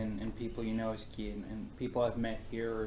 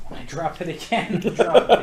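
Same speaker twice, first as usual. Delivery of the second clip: laughing through the words; 6 semitones higher, 13 dB louder than usual. Real, not acted. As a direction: giggle as you say it. Fumbling candy, laughing.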